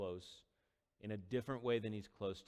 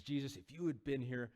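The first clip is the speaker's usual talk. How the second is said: angry, grieving reproach; 3 semitones higher than usual. Acted. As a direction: pour out grief as accusation. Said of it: "she's kinda angry".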